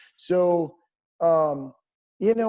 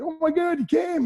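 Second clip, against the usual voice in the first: high, thrilled squeal of surprise; excited fan; 12 semitones higher than usual. Retold or imitated. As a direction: gush like a starstruck fan. Fans thrilled the band showed up.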